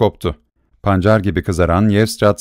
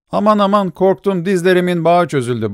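high, teasing fake fear; hammed-up scared squeal; mock fright; 8 semitones higher than usual. A narrator's reading. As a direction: pretend to tremble, laughing at them. Masked man mocks their anger.

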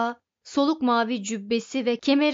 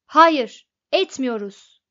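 irritated shout; 2 semitones higher, 10 dB louder, slower than usual. A narrator's reading. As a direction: burst out in annoyed contradiction. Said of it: "diye haykırdı Majiktis sinirle".

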